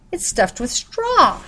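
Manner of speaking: high, slow exclaim; excited shout. Acted. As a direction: call it out with surprise. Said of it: "she shouted".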